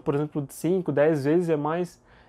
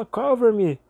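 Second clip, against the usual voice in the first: higher, slightly raised mock battle call; mock plea from a gunfight; soldier calling for cover; 7 semitones higher, 4 dB louder than usual. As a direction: call out for cover, lightly acted. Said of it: "com a metralhadora e fala".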